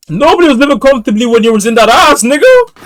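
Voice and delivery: loud, high protest rising at the end; incredulous yelling. Real, not acted. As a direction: yell back in disbelief. Yelling back at the story.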